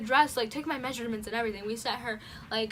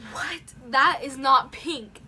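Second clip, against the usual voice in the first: shocked outburst; 8 dB louder, slower than usual. Retold or imitated. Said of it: "was like".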